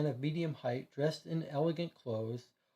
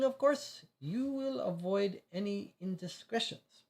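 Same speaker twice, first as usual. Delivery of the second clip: put-on a higher-pitched French accent, careful and measured; 4 semitones higher than usual. Consul weighing a delicate request, in a French accent. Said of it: "I can't keep a French accent".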